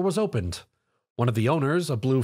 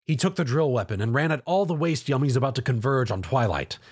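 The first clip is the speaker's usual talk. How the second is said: plain reading voice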